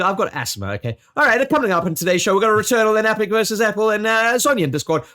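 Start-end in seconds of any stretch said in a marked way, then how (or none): 1.2-5.0 fast, high, loud race caller; rapid-fire announcer. Acted. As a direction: rattle it off like a race commentator. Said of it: "horse race commentators".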